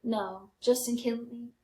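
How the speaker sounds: ordinary talk, nothing marked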